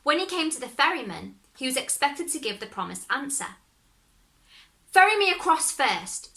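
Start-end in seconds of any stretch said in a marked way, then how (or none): 4.9-6.4 higher, louder demand; Luck child bargaining. Acted. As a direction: insist on being ferried first. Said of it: "said the Luck child".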